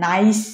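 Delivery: নাইস ending in a clear separate s; unassimilated wrong take. Shown as a demonstration. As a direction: keep a crisp s at the end of নাইস, not sh. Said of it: "হচ্ছে না কিন্তু".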